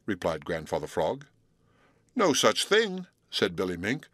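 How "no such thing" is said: high, indignant no such thing; sharp denial. Acted. Billy Mink scoffs at Grandfather Frog, high and indignant. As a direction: cut him off, indignant.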